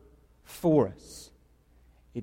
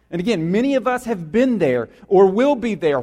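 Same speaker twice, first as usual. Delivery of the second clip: normal talking voice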